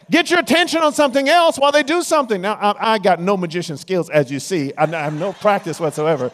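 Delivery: high loud excited preaching; fired-up preacher punchline; preaching at full force. Acted. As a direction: shout it triumphantly to the crowd.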